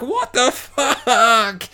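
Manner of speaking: slow, stretched high "what the fuck" through laughter; laughing disbelief. Retold or imitated. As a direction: drag it out while cracking up. Laughing at the gory scene.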